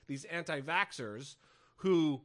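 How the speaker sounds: normal talking voice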